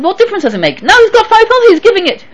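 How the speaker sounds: high, urgent protest; agitated. Retold or imitated. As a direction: push the point, rising. Rabbi retells a heated argument.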